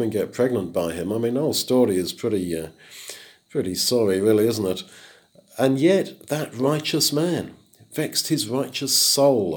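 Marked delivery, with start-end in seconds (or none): 6.3-9.6 slow reading that leans on "soul" at the end; stress on soul. Shown as a demonstration. Preacher dwelling on Lot's inner righteousness, stressing soul. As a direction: draw out "that righteous man vexed his righteous soul", weighting "soul". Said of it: "the stress on the word soul".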